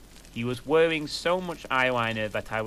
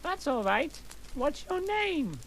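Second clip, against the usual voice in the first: much higher, breathy-sweet put-on girl voice; girly questioning voice. Ebony asking the pale goth boy his name, high and girly. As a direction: go way up into a sugary girl voice. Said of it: "I questioned".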